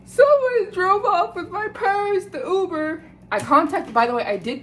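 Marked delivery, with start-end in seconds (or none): 0.1-3.0 high, tearful wail of a plea; pleading, close to tears, pitched high; crying. Retold or imitated. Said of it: "crying, and I'm going".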